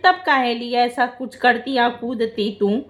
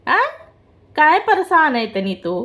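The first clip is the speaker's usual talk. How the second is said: usual speaking voice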